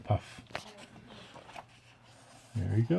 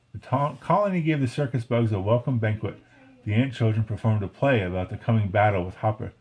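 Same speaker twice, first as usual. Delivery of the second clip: usual speaking voice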